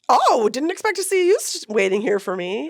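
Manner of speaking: high, loud mocking of her friend's surprise; imitating friend. Hiker mimics the late friend, high and loud. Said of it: "she was like".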